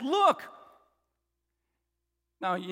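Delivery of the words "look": sharp, high, loud "Look"; emphatic reprimand. Retold or imitated. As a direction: imitate scolding a parent like a child. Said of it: "when you're reprimanding him".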